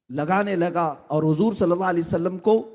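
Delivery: ordinary delivery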